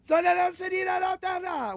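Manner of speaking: high-pitched nonsense syllables imitating a yell; hammed-up garbled movie quote; mock foreign shouting. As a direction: holler gibberish in place of the line. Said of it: "Whatever he says".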